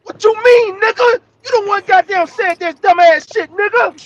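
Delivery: high, loud angry yelling; furious shout. Real, not acted. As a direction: scream it at him in outrage. Angry outburst at friend.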